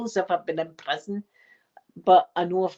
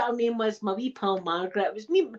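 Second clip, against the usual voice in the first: ordinary delivery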